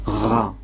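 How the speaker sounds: "Ra" with a throaty Kabyle gamma; Kabyle gamma sound. Shown as a demonstration. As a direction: demonstrate the gamma as a lone "Ra". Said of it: "ça se prononce". (« Ra », le gamma prononcé à la kabyle)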